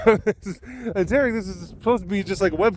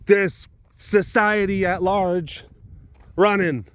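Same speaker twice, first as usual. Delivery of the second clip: mock rally speech; 5 semitones higher than usual. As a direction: rouse a crowd with drawn-out, high emphasis.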